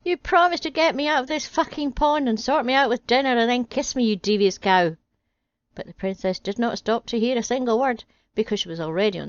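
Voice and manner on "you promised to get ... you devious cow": raised, higher calling voice; calling after her. Acted. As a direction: shout it after someone leaving. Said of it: "The frog called after her".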